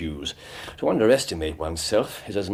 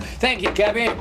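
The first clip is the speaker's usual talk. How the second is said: higher call to the cabby; 11 semitones higher than usual. Acted. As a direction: call up to the driver as you get out. Calling up to the cab driver.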